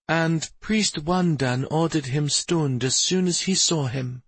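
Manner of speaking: usual speaking voice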